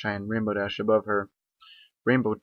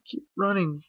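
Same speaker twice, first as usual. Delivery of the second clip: sobbing; 9 semitones higher, slower than usual. Acted. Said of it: "Twilight sobbed".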